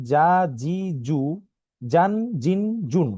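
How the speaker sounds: জা, জি, জু, জান, জিন, জুন in a firm, strong sound; firm syllables. Shown as a demonstration. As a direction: model strong জ forms. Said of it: "শক্ত এবং মজবুত আওয়াজে পড়তে হয়". (শক্ত আওয়াজে উচ্চারণ)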